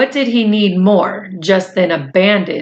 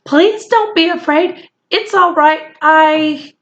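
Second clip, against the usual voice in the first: put-on high, hurried boy's voice; hasty boy; 8 semitones higher than usual. A narrator's reading. Boy hurriedly reassuring the frightened soldier, voiced high. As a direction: rush to calm the little man, pitched high. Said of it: "Omri said hastily".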